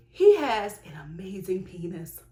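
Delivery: a secretive whisper. Real, not acted. Vlogger confiding to viewers in a whisper. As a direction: lean in and whisper it.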